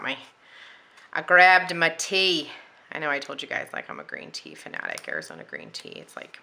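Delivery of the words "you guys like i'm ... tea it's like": whispering. Real, not acted. Aside while drinking tea.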